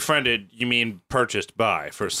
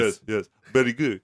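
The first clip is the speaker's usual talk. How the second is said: put-on Japanese accent; 3 semitones lower than usual. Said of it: "This is Japanese".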